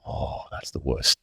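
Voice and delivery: breathy whispered groan of dread; hushed, slow dread at bad news; retold dread. Boy hearing wait till your dad hears, whispered dread. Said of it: "I'm like".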